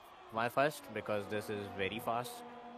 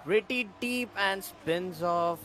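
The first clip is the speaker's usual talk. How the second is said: slow, higher, louder commentator call; excited; 8 semitones higher, 5 dB louder, slower than usual. Real, not acted. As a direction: call out the spin as it happens. Spin called live in commentary.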